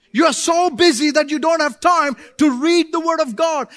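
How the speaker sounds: loud, driving sermon voice; full-force preaching. Acted. Pastor preaching hard to busy churchgoers, loud and driving.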